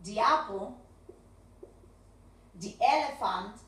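"The" said as thee in "The other man"; "The other man" with the long thee sound; the before vowel. Shown as a demonstration. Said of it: "cuando después de the viene una vocal".

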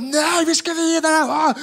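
put-on high, drawn-out party-kid voice; high, rowdy young comics wanting to party on. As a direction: whoop like hyped twenty-year-olds.

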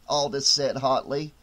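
plain reading voice

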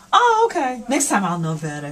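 cheerful mock realization. Nurse modelling what the patient will say, upbeat. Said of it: "You'll say".

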